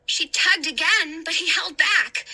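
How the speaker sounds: plain reading voice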